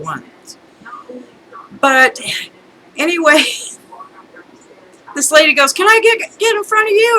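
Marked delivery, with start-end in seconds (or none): 5.7-7.2 high-pitched mock pleading request; put-on high voice of a queue jumper; imitated line-cutter. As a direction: mimic a pushy lady asking to cut in. Acted request of line-cutting lady.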